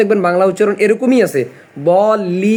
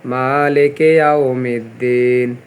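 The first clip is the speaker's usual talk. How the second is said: Quran recitation; 4 semitones lower than usual. Shown as a demonstration. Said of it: "ওয়াটাকে গোল করে উচ্চারণ করতে হবে".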